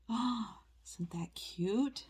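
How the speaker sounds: whispered gasp of delight, then soft; whispered oh, softly cute; breathy whisper. Real, not acted. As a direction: hush your voice in awe over something tiny. Gasping over a cute figurine.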